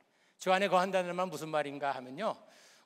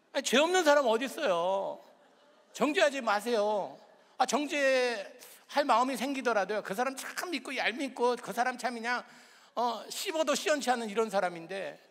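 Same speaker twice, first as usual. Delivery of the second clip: high, loud preacher's cry; fervent preaching; 6 semitones higher, 6 dB louder than usual. Acted. Pastor urging the congregation to confess, fervent. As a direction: drive the point home.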